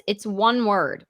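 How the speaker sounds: ordinary talk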